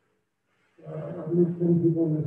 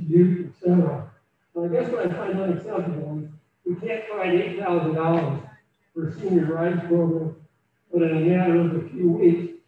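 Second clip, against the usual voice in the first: normal talking voice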